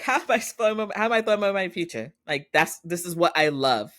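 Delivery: high-pitched, pleading mock outrage; indignant protest. Daughter defending her future to her dad, indignant.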